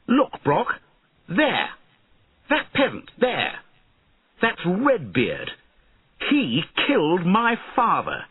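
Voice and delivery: high-pitched, agitated cry; urgent accusation. A narrator's reading. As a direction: call out an accusation to your companion. Young king spots his father's killer.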